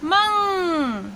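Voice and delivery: मंग stretched very slowly, nasal ending held; drawn-out syllable. Shown as a demonstration. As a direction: sound out मंग slowly for the class. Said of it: "अंग की मात्रा मिलाएंगे".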